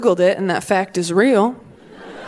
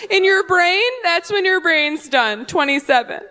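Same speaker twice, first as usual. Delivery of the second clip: high, smug know-it-all voice; preachy heckler; 7 semitones higher than usual. Retold or imitated. Heckler lecturing the comic on brains, smug and high. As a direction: explain it like it's obvious. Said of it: "She goes".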